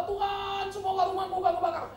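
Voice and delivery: high, loud, hammed-up prayer cry; mock shouted prayer. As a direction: wail it like a panicked believer.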